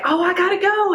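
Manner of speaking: high, light quick goodbye; hurried stranger taking her leave; breezy exit. Retold or imitated. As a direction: toss it off and hurry away. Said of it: "she's like".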